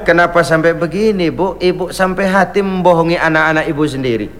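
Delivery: ordinary talk, nothing marked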